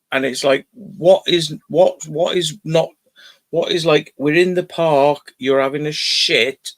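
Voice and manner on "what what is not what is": usual speaking voice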